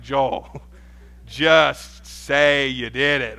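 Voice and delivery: put-on slow, drawn-out plea to a child; exasperated dad losing patience; exasperated parent. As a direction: drag out each word, fed up with the rambling. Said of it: "most irritating things as a parent".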